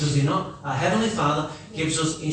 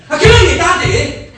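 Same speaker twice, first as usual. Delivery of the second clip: laughing mock shout, much higher and louder; 10 semitones higher, 11 dB louder than usual. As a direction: bark it out as a scolding. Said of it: "Rather than saying".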